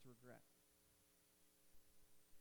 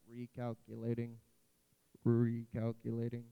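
put-on GPS voice with an English accent, low and loud; low, robotic English accent, drawn out on the repeat; mock English accent; 3 semitones lower, 15 dB louder than usual. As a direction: imitate an annoying English-accented sat-nav. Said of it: "dumb ac-, you know, English accent".

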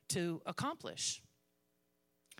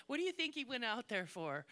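usual speaking voice

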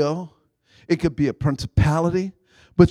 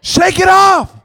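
higher, louder, rallying call; loud exhortation; 11 semitones higher, 7 dB louder than usual. Real, not acted. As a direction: call it out to the pews. Preacher urging congregation.

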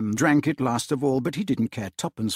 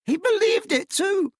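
much higher, laughing exclaim; laughing cry. A narrator's reading. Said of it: "cried Scrooge's nephew".

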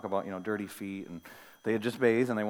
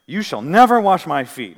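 loud, higher protest in a disciple's mouth; emphatic refusal; 4 semitones higher, 14 dB louder than usual. Acted. Disciple refusing to let Jesus wash his feet, emphatic refusal. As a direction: refuse outright, hitting the key word hard.

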